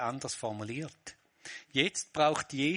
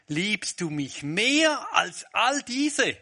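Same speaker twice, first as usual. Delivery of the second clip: 1 Jesus asking; 8 semitones higher, 7 dB louder, much slower than usual. Acted. Jesus questions Peter.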